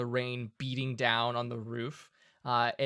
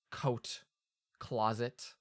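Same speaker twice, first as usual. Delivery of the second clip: ordinary talk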